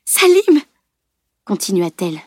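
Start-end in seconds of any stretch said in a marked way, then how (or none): 0.1-0.6 high, loud desperate shout; frightened, urgent yell; anguished call. A narrator's reading. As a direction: cry out his name in anguish. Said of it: "toute l'angoisse qu'elle éprouvait".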